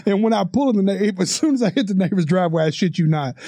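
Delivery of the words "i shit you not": ordinary delivery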